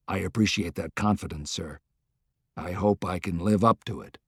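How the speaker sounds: quietly humble soldier answering his president; Grant, humble general. A narrator's reading.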